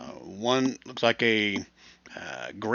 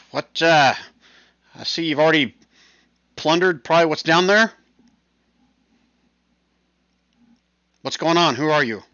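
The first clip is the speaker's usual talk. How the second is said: raised, louder questioning of strangers; a hint of a challenging stranger, pitched up; lightly voiced human lady; 6 semitones higher, 7 dB louder than usual. Human lady questioning the party about plundering, lightly voiced.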